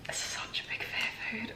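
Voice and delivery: whispering about the food she fears; frightened whisper. Real, not acted. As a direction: hush it out as if scared to say it. Facing feared chocolate bar.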